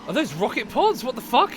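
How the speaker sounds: high, loud alarmed outburst; shocked shout. Real, not acted. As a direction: blurt it out in sudden alarm. Plane attacking his U-boat.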